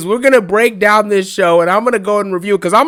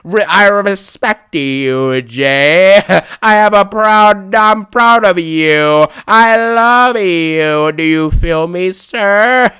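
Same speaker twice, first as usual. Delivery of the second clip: slow, drawn-out mock sincerity; mocking Cody Rhodes; much slower than usual. Mocking Cody's promo to Jey, very slow and syrupy. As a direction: stretch every word like a sappy champion. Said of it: "comes out here and says the same stuff".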